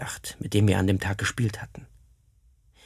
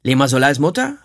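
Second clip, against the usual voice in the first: ruft laut nach der Mutter; fordernder, lauter Ruf vor der Hütte; 4 semitones higher, 7 dB louder than usual. Acted. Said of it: "rief er". (Krieger ruft vor der Hütte nach der Mutter, laut)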